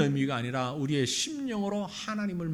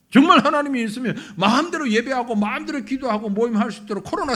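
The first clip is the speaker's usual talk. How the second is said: higher, louder scoffing rant; scoffing unbeliever; 6 semitones higher, 5 dB louder than usual. Retold or imitated. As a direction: sneer at the worship during Covid. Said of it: "그런 얘기하는 사람들이 있습니다". (코로나 때 교인을 비웃는 불신자의 높은 조롱)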